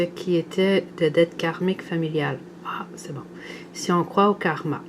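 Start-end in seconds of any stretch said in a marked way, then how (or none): 2.6-3.2 soft whispered sigh of relief. Real, not acted. Whispering her relief while reading to viewers. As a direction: breathe it out under the breath.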